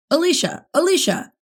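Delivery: high, loud shout through the door; calling out loud. A narrator's reading. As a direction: yell her name to be heard through a door. Randy pounding on the door, yelling.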